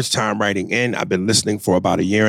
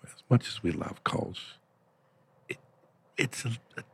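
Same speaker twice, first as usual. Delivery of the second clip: much softer, near-whispered aside; 3 semitones lower, 11 dB quieter than usual. Real, not acted. Host confers with co-host about the caller, hushed whisper. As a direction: lean in and whisper to your co-host.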